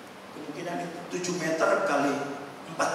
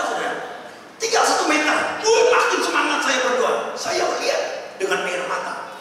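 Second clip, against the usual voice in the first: ordinary delivery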